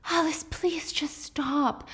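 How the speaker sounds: hushed, slow whisper; whispered plea. Acted. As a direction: beg her to stop, barely voiced. Jack hushes Alice.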